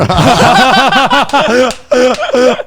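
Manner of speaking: big high burst of laughter; loud laugh. Real, not acted. Laughing hard at his own story, a big burst. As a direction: crack up loudly.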